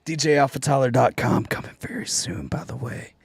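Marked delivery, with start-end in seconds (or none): 1.5-3.1 unvoiced teasing whisper, much softer; hushed aside, like sharing a secret. Teasing upcoming website launch.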